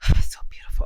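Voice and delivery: hushed, moved whisper; awed whisper. Real, not acted. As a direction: breathe it out in wonder. Admiring handwritten name.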